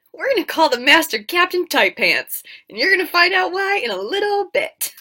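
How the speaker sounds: gleeful high teasing aside; excited aside. Real, not acted. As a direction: let the joke bubble up loudly. Comic aside naming the villain.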